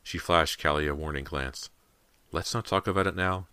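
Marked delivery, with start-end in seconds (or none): none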